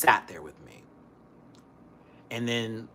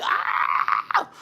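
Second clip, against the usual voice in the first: loud acted-out scream; mock bathroom-emergency scream; panicked scream; 7 dB louder than usual. Acts out running to the bathroom.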